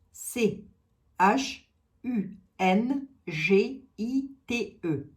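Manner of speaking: slow letter-by-letter spelling; spelled out letter by letter. Shown as a demonstration. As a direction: spell it out slowly, each letter apart. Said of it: "spelling the word out".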